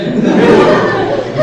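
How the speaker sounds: high loud giggle; burst of laughter. Real, not acted. Recalling greeting the chief guest, giggling laugh. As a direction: laugh out at the memory.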